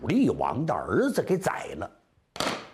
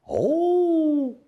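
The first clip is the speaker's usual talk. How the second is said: long, high sustained ooh; high-pitched sound of dawning understanding; drawn-out acted "oh"; 14 semitones higher, 4 dB louder than usual. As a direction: stretch it out high, as if taking it in. Listener's drawn-out response in story.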